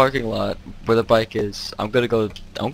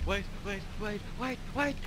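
frantic, rising wait wait; panicked rising pleas; 8 semitones higher, 13 dB quieter than usual. Real, not acted. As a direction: voice climbing higher in panic. Panicking as monster nears.

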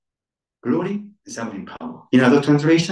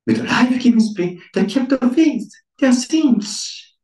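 rising, louder preaching, last words slowed; 11 semitones higher, 5 dB louder than usual. Acted. Preacher proclaims the faithful are saints, building.

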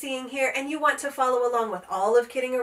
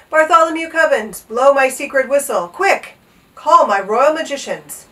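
loud, high, urgent order; excited royal command; 3 semitones higher, 11 dB louder than usual. A narrator's reading. King summoning magicians through his page boy, commanding. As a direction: call it out with eager authority.

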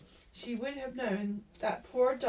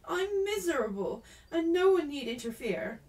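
high, louder, upset retort; miserable outburst; 6 semitones higher, 5 dB louder than usual. A narrator's reading. Ermengarde caught crying by Jessie, miserable and defensive. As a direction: push the nosy girl away, pitch raised.

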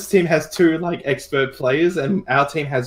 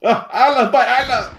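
mock dramatic cry; 9 semitones higher than usual.

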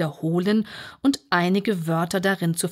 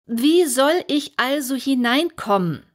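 raised, insistent question; Alice asks again, a little louder; a bit louder; 7 semitones higher, 5 dB louder than usual. Acted. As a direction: ask again, somewhat louder. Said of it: "wiederholte sie etwas lauter".

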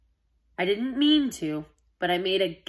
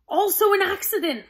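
high, nervous stammer; 12 semitones higher, 4 dB louder than usual. Acted. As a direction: squeak it out nervously. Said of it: "I stammered".

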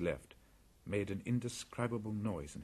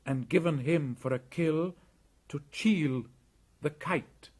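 put-on young boy voice; 7 semitones higher than usual. Mowgli explains the poisoned kill.

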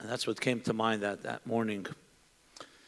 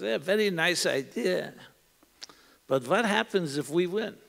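put-on thick Yiddish accent; 5 semitones higher than usual. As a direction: play the old man with a thick Yiddish accent. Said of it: "in a thick accent".

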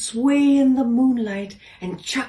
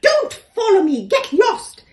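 high, loud fleeing scream; 12 semitones higher, 6 dB louder than usual. A narrator's reading. Peacock fleeing the door, screaming high and loud. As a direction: scream it while running away. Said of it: "it screamed as it fled".

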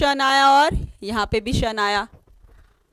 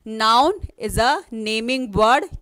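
slow dictation; 2 semitones lower, much slower than usual. Shown as a demonstration. Teacher dictating the definition.

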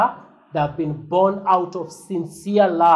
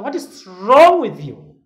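a hint of a baffled father, loud and slow; slow, loud what-is-wrong; puzzled father; 6 semitones higher, 11 dB louder than usual. Father puzzled by his shouting son, mildly voiced. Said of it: "my father will want".

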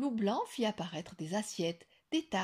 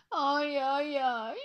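high pained aïe aïe; whimpering in pain; moaning ouch; 6 semitones higher, 5 dB louder, much slower than usual. A narrator's reading. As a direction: wail it like a stung wolf. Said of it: "gémit-il".

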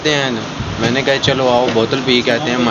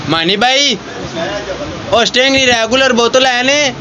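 calling out; 8 semitones higher than usual. Real, not acted. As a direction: shout to someone far off. Calling out to distant Mani.

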